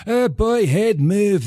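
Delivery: over-the-top Scottish accent, pitched high; Shrek. Shown as a demonstration. Voice actor giving an accent tutorial, hammed-up Scottish ogre. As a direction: ham up the Scottish, high and broad. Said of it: "your over the top Scottish".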